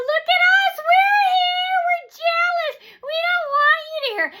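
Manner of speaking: very high, loud shrieked taunt; mock screaming. Imitating jealous hecklers during appointments, screaming. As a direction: screech it in imitation. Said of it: "Dave would also scream".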